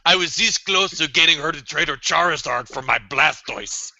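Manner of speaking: ordinary talk, nothing marked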